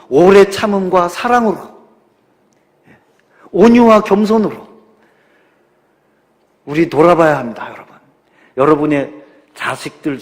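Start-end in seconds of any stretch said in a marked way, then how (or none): 0.1-4.7 loud, high scripture proclamation; preaching scripture. Acted. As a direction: proclaim the verse from the pulpit. Quoting scripture forcefully. (힘차게 성경 구절을 선포하듯)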